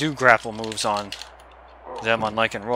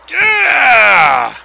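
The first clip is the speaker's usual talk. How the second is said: high, loud victory yell; triumphant yell; 12 semitones higher, 12 dB louder than usual. Real, not acted. Gamer celebrating a big move, triumphant yell. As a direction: roar at the win.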